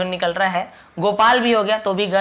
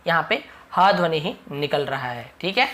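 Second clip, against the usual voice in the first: usual speaking voice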